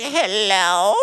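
drawn-out, put-on old man voice; elderly man. Elderly gentleman answering the phone, exaggerated old-man voice. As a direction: stretch it like an aged man answering. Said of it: "He sounded elderly".

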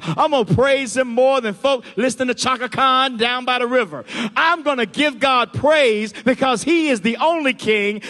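loud, driving sermon delivery; full-force preaching. Real, not acted. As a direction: preach it hard, building in pitch and force.

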